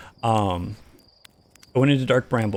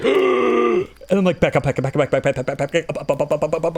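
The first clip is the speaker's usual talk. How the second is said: panicked gasp; 5 semitones higher than usual. Retold or imitated. Fleeing the anglerfish in the game, panicked chant. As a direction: gasp then frantic back up.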